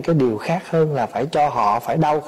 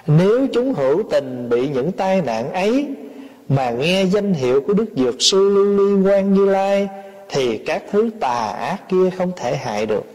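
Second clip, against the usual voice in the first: sutra recitation; 4 semitones higher than usual. A narrator's reading. Preacher reads the sutra to followers, solemn recitation. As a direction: intone the verse, drawing out the Buddha's name.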